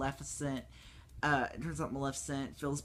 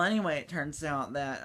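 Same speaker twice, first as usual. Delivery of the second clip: usual speaking voice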